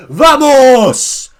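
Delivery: loud, high cry of triumph; victory shout. Real, not acted. Fan gloating to his chat as Salah blanks, loud triumphant shout. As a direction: yell it like a goal celebration. Celebrating rival player blanking.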